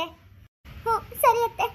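a bride holding back tears as she leaves home; crying bride. Acted. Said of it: "ಅಳ್ಬೇಡಮ್ಮ".

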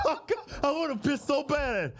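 high whining plea; mock desperate whine. Man waiting in line behind the old man, mock desperate whine. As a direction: whine it, half-joking.

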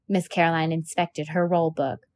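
ordinary delivery, nothing marked

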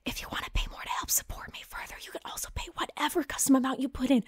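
quick whispered aside; fast whisper. Acted.

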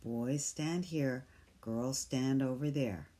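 ordinary delivery, nothing marked

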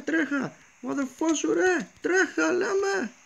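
high frantic calls to flee; real alarm, pitched high; panicked urging to run. Real, not acted. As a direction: yell at your friend to run for it. Fleeing Herobrine, urging friend run.

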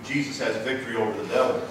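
normal talking voice

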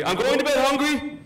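put-on high, loud little-kid defiance; defiant toddler voice. Toddler mocking his dad's warning in a high loud voice. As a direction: toss it back like a cocky three-year-old. Three-year-old mimics his dad.

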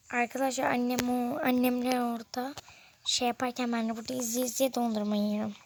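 usual speaking voice

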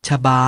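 reading example words aloud to students, clear and distinct; word read out for class. Shown as a demonstration. Teacher reads example word to kids, clear and distinct. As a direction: sound it out for the class. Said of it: "จากตัวอย่างทั้งห้าคำ".

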